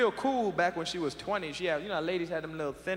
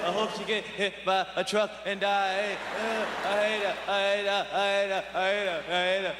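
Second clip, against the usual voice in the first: put-on kid's sulky prayer; 2 semitones higher than usual. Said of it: "Be in the bedroom going".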